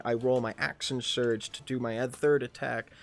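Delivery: usual speaking voice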